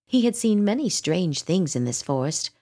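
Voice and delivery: ordinary delivery, nothing marked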